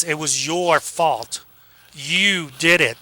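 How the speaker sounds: slow, each word weighted; deliberate slow accusing emphasis; emphatic accusation. Shown as a demonstration. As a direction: lean on every word of "It was your fault. You did it.". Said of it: "So the emphasis".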